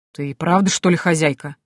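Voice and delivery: ordinary delivery, nothing marked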